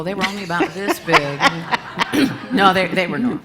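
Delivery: laughing through a silly joke. Real, not acted. Joking to the congregation about tiny audience members, laughing. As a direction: chuckle while teasing the crowd.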